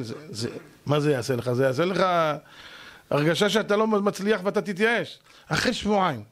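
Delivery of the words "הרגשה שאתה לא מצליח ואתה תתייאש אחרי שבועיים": normal talking voice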